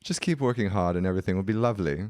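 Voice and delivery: brisk middle-aged British lady; quick, encouraging British accent; British woman. Retold or imitated. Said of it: "a British woman, like, a middle-aged British woman".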